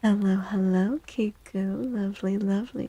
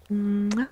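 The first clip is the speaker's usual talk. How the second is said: kiss sound. Pretend. Kiss on the forehead.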